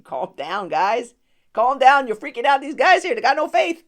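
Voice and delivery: loud, high playful scolding; hammed-up Jesus. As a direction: tease the scared guys loudly.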